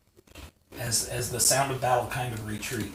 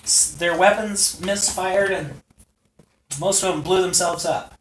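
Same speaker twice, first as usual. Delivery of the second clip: higher, louder smug dragon man; Lanny boasting; 6 semitones higher, 8 dB louder than usual. Acted. Lanny tells the heroes how the enemy fled, smug and loud. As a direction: brag about the rout, high and loud.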